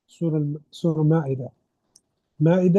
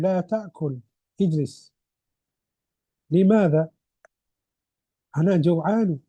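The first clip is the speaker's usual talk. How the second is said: reading a husband-and-wife dialogue aloud. Acted. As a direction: read the lesson dialogue clearly, line by line. Teacher reads a dialogue aloud.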